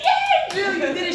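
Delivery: high, loud celebratory cheer; delighted, congratulatory whoop; happy cheer. Retold or imitated. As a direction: imitate a friend cheering you on. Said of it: "She was just so happy for me".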